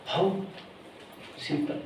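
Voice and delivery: ordinary talk, nothing marked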